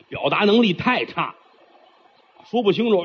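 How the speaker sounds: normal talking voice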